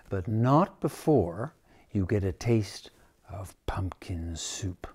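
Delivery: hushed whisper. Acted. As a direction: whisper it like a secret. Teasing the story.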